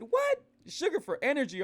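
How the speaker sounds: mock shocked disbelief at a friend's claim; retold disbelief. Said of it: "I said".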